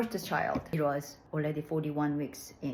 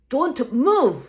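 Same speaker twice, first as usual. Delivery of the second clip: much louder, clipped command; stern order; 9 semitones higher, 15 dB louder than usual. Retold or imitated. Doctor ordering the patient during the epidural, stern and loud. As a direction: bark it as a firm warning. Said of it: "He kept saying it sternly".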